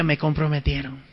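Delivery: "Me comprometieron" said softly to himself; soft dismayed "Me comprometieron"; quiet aside. Retold or imitated. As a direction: mutter "Me comprometieron" under the breath. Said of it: "Bueno, dije".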